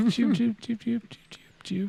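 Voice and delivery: high squeaky chirps; chinchilla noise. Pretend. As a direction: squeak like a small rodent. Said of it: "that sounds just like a chinchilla".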